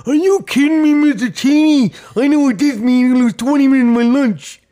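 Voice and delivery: a student groaning about lost lunch; whining student. Pretend. Voicing a student's complaint.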